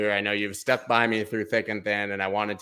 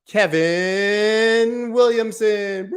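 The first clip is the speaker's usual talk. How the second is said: slow, drawn-out arena announcer call, higher; 9 semitones higher, 5 dB louder, much slower than usual. Pretend. Streamer announcing giveaway winner, arena announcer voice. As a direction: stretch the name like a stadium intro. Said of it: "I have a pretty good announcer voice".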